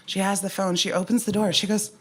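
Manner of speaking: ordinary delivery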